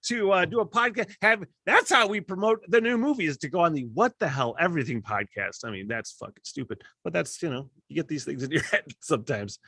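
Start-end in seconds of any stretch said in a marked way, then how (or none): 0.1-4.6 high, excited fanboy-style praise. Pretend. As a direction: pitch up into a thrilled rock star gushing. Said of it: "[name]'s gonna see that shit and be like".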